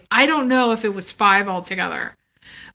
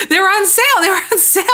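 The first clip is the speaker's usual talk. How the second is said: laughing disbelief; 8 semitones higher, much faster than usual. Real, not acted. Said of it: "my mind was like".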